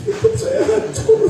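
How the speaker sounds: high, drawn-out comic sobbing wail; mock crying. Complainer visiting an ustadz, imitated with mock crying. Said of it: "nangis-nangis".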